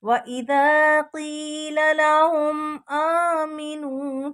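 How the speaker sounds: slow melodic Quran chant; tajweed recitation. Shown as a demonstration. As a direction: chant the verse with long vowels. Recites the verse slowly. (धीमी तजवीद तिलावत)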